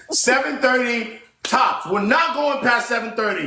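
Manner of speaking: high, insistent plea; begging. Real, not acted. Host begging panel to end by seven thirty, insistent. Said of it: "I'm begging y'all".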